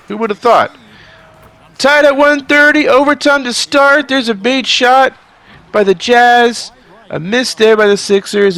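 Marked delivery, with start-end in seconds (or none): 1.8-8.6 high, loud play-by-play burst; energetic announcer voice; excited call. Acted. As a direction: call the overtime tip like it matters.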